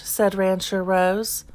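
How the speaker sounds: plain reading voice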